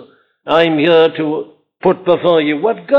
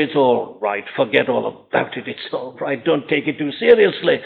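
low, softer mock reassurance; dismissive excuser; 4 semitones lower, 4 dB quieter than usual. Preacher voices a listener excusing sin, soothing and dismissive. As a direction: wave it away quietly, voice dropping. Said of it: "when a man sins, you say".

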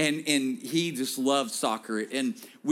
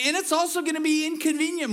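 preacher's call; 11 semitones higher, faster than usual. Shown as a demonstration. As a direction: call it out fast for them to repeat. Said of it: "turn back to them and say".

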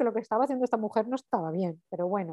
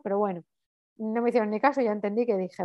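ordinary delivery

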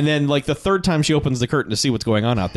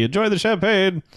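higher, hammed-up parting flourish; mock grand farewell; 7 semitones higher than usual. Blackula excuses himself from the club, mock grand farewell. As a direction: sign off like a courtly host.

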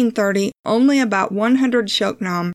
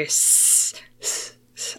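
unvoiced snake hiss on s; drawn-out s like a snake; 7 dB quieter, much slower than usual. Real, not acted. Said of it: "I sound like a snake".

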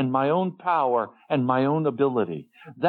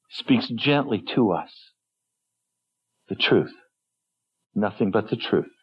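normal talking voice

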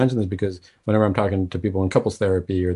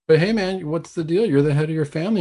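higher, louder, hammed-up he-man bluster; teasing a guest as devil's advocate, mock macho bluster; mock macho husband; 4 semitones higher, 4 dB louder than usual. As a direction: play the bossy head of the house for laughs. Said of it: "I'm playing devil's advocate".

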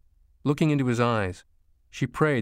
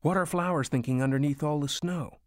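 put-on higher child's voice, proud recital; enthusiastic little boy; 6 semitones higher than usual. A narrator's reading. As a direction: recite your story title like a child. Excited boy recalls his story title.

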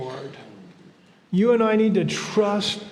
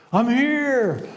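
voice of God; 5 semitones higher than usual. Retold or imitated. Voice from the heavens.

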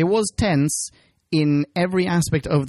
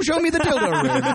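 mock Amy line; 13 semitones higher than usual. Mocking Amy's lines in the episode.

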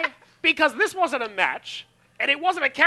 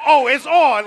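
mock eager; 2 semitones higher, 8 dB louder, slower than usual. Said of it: "Becky was like".

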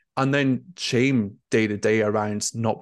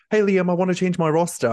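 lightly voiced casual junior colleague, pitched higher; breezy graduate; 8 semitones higher, 4 dB louder than usual. Graduate strolling into the manager's office, higher and cheery. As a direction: breeze in, oblivious, with a casual request.

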